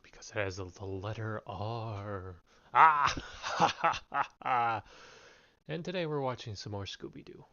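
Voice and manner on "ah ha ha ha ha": loud villain laugh; booming spooky cackle; evil cackle. Acted.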